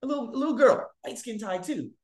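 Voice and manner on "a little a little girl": normal talking voice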